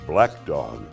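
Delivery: ordinary delivery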